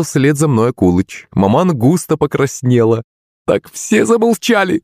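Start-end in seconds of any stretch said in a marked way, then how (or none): 3.5-4.8 high, drawn-out command; cried out. A narrator's reading. Embarrassed maman silences everyone with a high cry. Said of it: "вскрикнула она".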